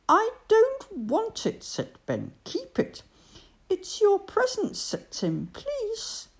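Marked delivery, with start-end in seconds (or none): 0.1-1.6 high, loud mouse voice refusing; high refusal. Acted. Ben refuses the present. 2.4-4.8 high little mouse voice insisting. Acted. Tim insists. 5.5-6.3 pleading. Acted. Tim pleads.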